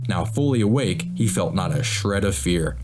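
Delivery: ordinary delivery, nothing marked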